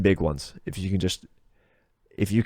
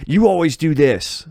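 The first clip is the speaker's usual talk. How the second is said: accusing; 7 semitones higher, 7 dB louder than usual. Retold or imitated. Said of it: "instead of saying, like".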